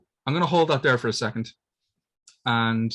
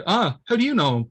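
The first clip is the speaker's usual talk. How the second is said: puzzled question; 8 semitones higher, 4 dB louder than usual. Retold or imitated. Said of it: "And Titus is like".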